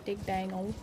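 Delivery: put-on Boomhauer accent. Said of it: "You almost have the Boomhauer accent".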